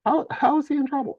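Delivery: very high, incredulous questioning; incredulous challenge. Real, not acted. Co-host defending Alan from the accusation, incredulous. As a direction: question it in a squeaky, disbelieving pitch.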